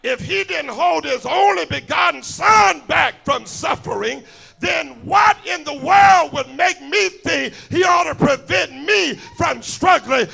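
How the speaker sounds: rising, shouting preacher cadence; preaching at full force. Acted. Preacher pressing the congregation, full-force shout. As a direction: drive the question home to the church.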